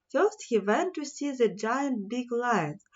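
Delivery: plain reading voice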